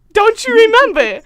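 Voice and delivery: punchy, put-out reminder; exasperated comeback. Retold or imitated. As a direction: throw it back, disbelieving. Acted exasperated comeback to clerk.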